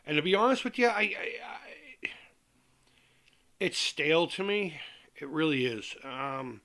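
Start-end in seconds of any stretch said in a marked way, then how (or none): 0.9-2.1 whispered stammer. Real, not acted. Hesitant, breathy stammer.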